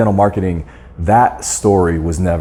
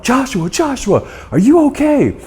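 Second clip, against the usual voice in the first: high-pitched imitation of a yelling mother; mom yelling out. Mom calls to her son after the car flips, yelling out high. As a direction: call out high and urgent, as mom. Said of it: "my mom is yelling out".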